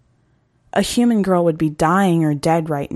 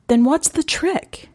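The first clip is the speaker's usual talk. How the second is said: higher, slow, earnest question; eager girl; 10 semitones higher than usual. Acted. Girl pressing the hunter for his secret. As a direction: ask him, hanging on his answer.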